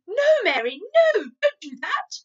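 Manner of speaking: loud, high, sharp refusal; sharp command. Acted. Queen sharply stopping Mary from removing Sophie, loud. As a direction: cut the maid off sharply. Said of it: "The Queen spoke so sharply".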